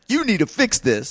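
high, insistent urging; pleading demand. Retold or imitated. Homeowner urging the city risk manager, high and insistent. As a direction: press him hard to act.

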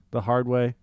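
ordinary talk, nothing marked

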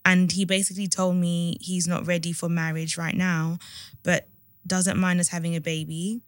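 measured, subdued read-aloud voice; letter reading. A narrator's reading. Host reading a listener's dilemma to co-host, subdued read-aloud.

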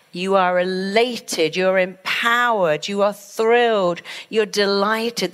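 slow, emphatic pronouncements; elated affirmation. Shown as a demonstration. Teacher affirming her students, elated and emphatic. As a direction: stretch each uplifting word with conviction. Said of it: "I use the same words all the time when I'm teaching my students".

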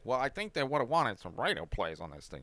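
soft, quick put-on lawyer voice over the phone. [name] on a payphone to the label, faking a lawyer, soft put-on voice. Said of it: "putting on some voice".

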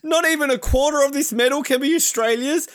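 high mock pleading from an interviewer; Mel McLaughlin. Interviewer begging skier for a share, mock pleading. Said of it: "And she's all like".

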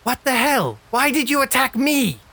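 a voice from nowhere, high and affronted; indignant voice from [name]. Acted. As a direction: play the hidden voice crying out in protest. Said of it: "Clarence, you hear this voice".

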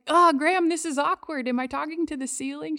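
self-conscious, wooden first attempt at prayer; awkward young self. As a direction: play your awkward college self asking grandma. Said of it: "I would awkwardly and kind of woodenly".

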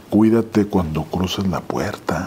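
slow, low acted warning; veiled threat. Imitating the stranger's threat.

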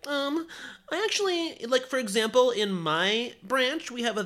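high, nerdy eager voice; goody-goody know-it-all. Retold or imitated. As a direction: sound like a teacher's pet. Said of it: "they need to be like".

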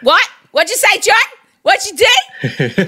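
high-pitched, put-on disbelief; mock incredulous questioning. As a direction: press him like a baffled partygoer. Acted partygoers question the mumbling hacker.

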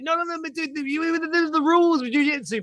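high-pitched exasperated explaining; mock protest. Winner sets the beaten opponent straight, exasperated. Acted argument with annoyed opponent.

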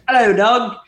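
high, loud put-on British phone greeting; a hammed-up English accent, loud and high; mock English accent. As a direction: answer the phone like a posh English call-center agent. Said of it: "A call center full of English people".

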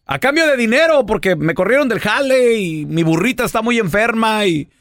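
high, pleading whine; el feo. Acted. Poor man bargaining with the devil, high pleading. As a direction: plead your hard-luck case in a high voice. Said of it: "grita el feo".

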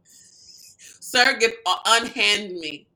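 loud, laughing mock-offended sir; hammed-up theatrical unhand me; hammy stage actor. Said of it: "Back it up".

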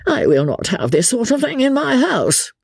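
high-pitched, mildly panicky objection; alarmed protest. A narrator's reading. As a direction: object anxiously, pitch climbing well up. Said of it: "alarmed and anxious".

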